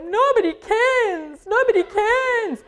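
hammed-up self-pity, stretched out; mock wailing. Weeper answers the Powerpuff Girls' question, mock wailing.

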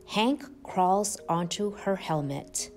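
ordinary delivery

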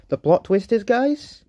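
high, excited build-up. Real, not acted. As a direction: tease the big reveal. Announcing plot twist to viewers.

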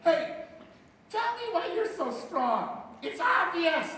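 high, slow, silly question to a muscleman; mock asking. Preacher mocking someone quizzing The Rock, high slow mock question. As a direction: ask it like a wide-eyed fan. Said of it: "and say".

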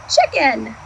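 plain reading voice

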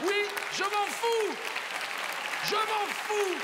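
high, drawn-out brush-off; defiant. Acted. Comedian answers the laughing audience, defiant.

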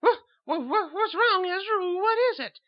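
very high, loud frightened stammer; jolted from sleep in fright; startled awake. Acted. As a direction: sister woken roughly by her brother. Woken roughly, frightened.

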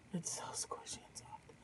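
hushed, admiring whisper. Real, not acted. Crafter whispers to viewers admiring soft gifted yarn.

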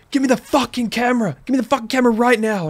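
high-pitched imitated robber's yell; retold angry demand. Robber threatening him, acted out.

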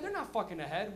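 ordinary delivery

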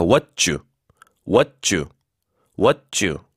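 "what you" said as "wha-tchoo", tense ch; linked t-y as jju. Shown as a demonstration. Teacher drilling "what you", t and y fused as 쭈. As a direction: merge t and y in "what you" into a tight 쭈. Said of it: "쌍지읒 소리를 내서 쭈".

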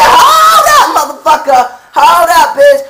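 high, drawn-out shout; loud, explosive comic outrage; furious interruption. Retold or imitated. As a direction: cut her off at full volume. Acted shouting down of customer.

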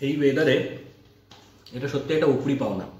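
talking with mouthful; speaking between bites; chewing food. Real, not acted. Seen 3: Took a bite at -1s; talks over rice meal, chewing.

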